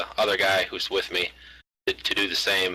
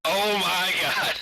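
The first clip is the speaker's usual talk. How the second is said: mock panic; 10 semitones higher, much slower than usual. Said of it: "is he just like".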